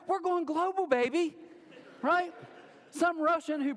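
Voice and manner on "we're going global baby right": high-pitched, playful hype; mock triumphant boast. Preacher teasing an unimpressed congregation, mock triumphant boast. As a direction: crow it like a big-shot, tongue in cheek.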